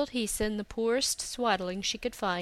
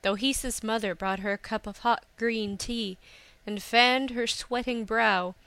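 ordinary delivery

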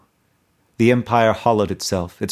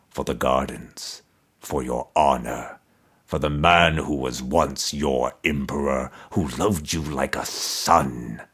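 resonant toneless skull; 7 semitones lower than usual. A narrator's reading. Buried emperor's skull answering Mordecai, low resonant toneless voice. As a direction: intone it without any tune, deep in the earth. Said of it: "answered in a resonant voice, toneless".

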